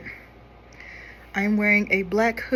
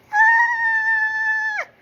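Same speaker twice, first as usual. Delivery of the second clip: shrill, very high falsetto shriek, loud; high-pitched female scream; 7 dB louder than usual. Retold or imitated. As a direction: shriek like a startled woman, up at the top of the voice. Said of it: "he just did this high-pitched female scream".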